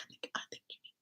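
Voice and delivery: barely-there voiceless whisper; secret whisper. Retold or imitated. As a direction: whisper it so the friend won't hear. Secretly urging cousin to tell.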